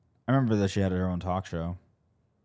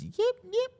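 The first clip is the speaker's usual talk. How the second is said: yappy chihuahua voice. Retold or imitated. Imitating the neighbour's yappy dog.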